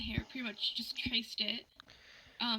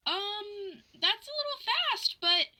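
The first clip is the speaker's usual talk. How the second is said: incredulous high squeak; 9 semitones higher than usual. Retold or imitated. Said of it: "And I'm just like".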